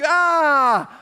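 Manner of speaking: long, high, loud "ah"; all-out diving yell. Acted. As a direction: yell as if hurling yourself at a kick.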